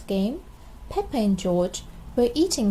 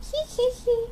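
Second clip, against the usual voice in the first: piggy giggle; 13 semitones higher than usual. A narrator's reading. Family giggles.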